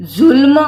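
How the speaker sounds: जुल्म said with a buzzing z, not j; z sound. Shown as a demonstration. Teacher shows the nukta sound, जुल्म with a z. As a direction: start जुल्म with a z. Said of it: "नुक्ता जब हम लगा देते हैं".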